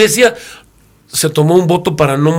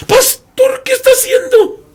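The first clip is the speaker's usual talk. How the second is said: much higher, scandalized cry; shocked young woman; 4 dB louder than usual. Retold or imitated. A young woman shocked the pastor sat on the platform, high scandalized cry. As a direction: gasp it out as if he's done something sacrilegious.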